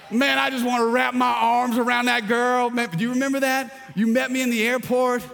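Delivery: high, loud, delighted calling out; joyful shout. Real, not acted. Preacher recalling his wife at the airport, loud and joyful.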